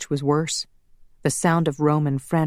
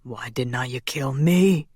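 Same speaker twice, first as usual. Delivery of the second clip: ordinary delivery, nothing marked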